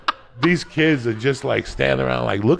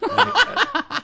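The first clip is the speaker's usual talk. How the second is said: laughing; 5 dB louder than usual. Real, not acted. Laughing mid-story.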